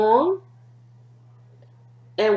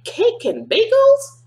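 excited exclamation; 6 dB louder than usual. Acted. Refrain, quick exclamation.

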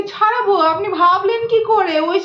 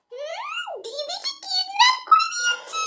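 high, giddy squealing delight; little sister thrilled her sister was kidnapped; gleeful; 12 semitones higher than usual. Acted. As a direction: gloat happily over the news.

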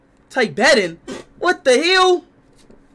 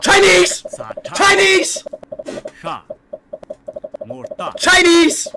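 excited guess; 6 semitones higher, slower than usual. Real, not acted. Streamer shouting his guess at the quiz. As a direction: blurt it out sure you're right.

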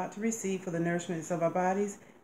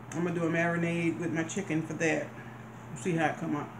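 chewing while talking; speaking between bites; mouth full. Real, not acted. Seen 3: Woman talks between bites, lips chewing, forkful of food held up.

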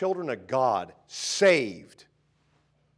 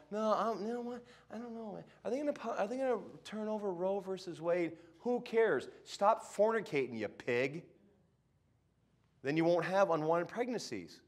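higher, careless put-on voice; mocking a shrugging churchgoer in a higher voice; 7 semitones higher than usual. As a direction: sneer out a careless believer's words.

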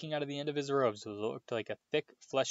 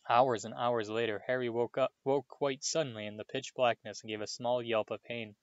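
plain reading voice

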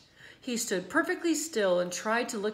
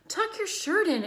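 higher call across the crowd; 11 semitones higher, 5 dB louder than usual. A narrator's reading. Mother calling to her son, higher. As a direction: call out to the boy. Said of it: "called Missus Zuckerman".

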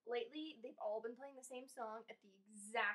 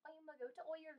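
put-on Irish accent; 5 semitones higher, 5 dB quieter, much faster than usual. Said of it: "Irish accent?".

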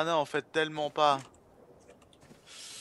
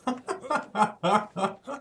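scared horror game streamer; 9 semitones higher than usual. Real, not acted. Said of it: "il m'a fait trop peur".

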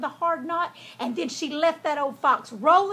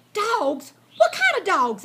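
high, louder startled question; alarmed fox; 7 semitones higher, 5 dB louder than usual. A narrator's reading. Fox hears that dogs are barking, alarmed. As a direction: ask in sudden alarm.

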